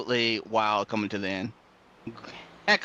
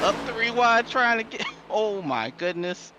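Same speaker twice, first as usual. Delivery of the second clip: excited; 9 semitones higher than usual. Real, not acted. Co-commentator reacting to wild finish.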